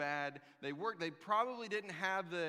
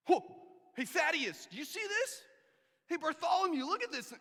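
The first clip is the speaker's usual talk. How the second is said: high, loud startled calls to a friend; startled calling out; 10 semitones higher, 5 dB louder than usual. Acted. Shepherd calling to his friends at the angel, high excited shouts. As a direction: yell to your buddy, seeing something unbelievable.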